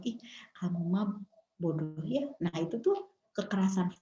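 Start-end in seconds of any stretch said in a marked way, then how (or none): 0.0-2.3 soft, low-key insult with a smile; smiling put-down. Shown as a demonstration. Parent belittling a child, smiling and soft. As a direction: insult them quietly, as if smiling. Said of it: "misalnya dengan senyum-senyum".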